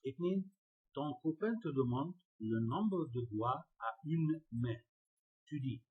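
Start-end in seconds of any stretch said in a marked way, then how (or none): 0.9-4.8 slow dictation reading. Shown as a demonstration. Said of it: "reading French question slowly".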